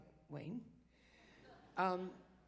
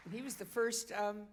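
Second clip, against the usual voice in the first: normal talking voice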